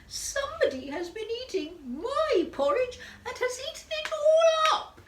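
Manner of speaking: very high squeak building to a shriek; squeaky baby bear. A narrator's reading. Little Bear finding his porridge all eaten, squeaky wail. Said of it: "squeaky baby voice".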